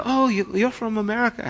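rough attempt at a Welsh local's accent; imitated Welsh accent. Welsh local spotting an American tourist, imitated Welsh accent. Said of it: "However they talk".

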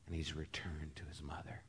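a whispered ending to the miracle story; hushed whisper. A narrator's reading. Preacher whispering the climax of the story to the congregation. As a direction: breathe it out almost without voice.

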